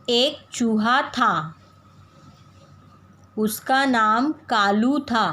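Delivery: put-on slow, sing-song poem reading for kids. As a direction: read slowly for children to follow. Reads poem to children. (बच्चों को धीरे कविता पढ़कर सुनाना)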